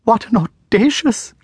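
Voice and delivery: too outraged to finish; shocked Miss Jemima. A narrator's reading. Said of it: "Emotion prevented her from completing".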